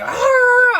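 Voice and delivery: loud high imitated dog bark. Imitating the dog that barked at the cop. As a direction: bark it out like a mad country dog. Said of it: "this dog is like".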